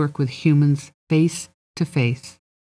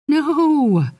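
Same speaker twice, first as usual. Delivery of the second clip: dismayed outcry; 12 semitones higher, 3 dB louder than usual. A narrator's reading. Fairy dismayed client may leave.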